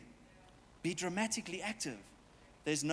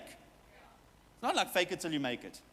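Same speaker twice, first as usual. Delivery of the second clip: ordinary talk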